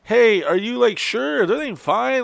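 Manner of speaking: high-pitched, tentative concern; concerned check-in. Retold or imitated. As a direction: imitate gently probing if someone is okay. Acts out checking she's okay.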